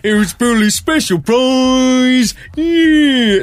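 slow, loud, high game show cry; loud drawn-out shout. Pretend. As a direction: belt it like a game show host. Singing Bullseye catchphrase.

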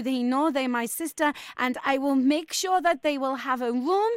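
mock Spanish hotel manager, polite but firm; fake Spanish accent. Prank hotel manager to angry caller, in a fake Spanish accent. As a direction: put on a Spanish accent and keep it courteous.

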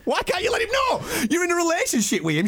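indignant yelling; exasperated shout. Real, not acted. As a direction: blast the disbelief at her. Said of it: "exasperated at girlfriend's mate".

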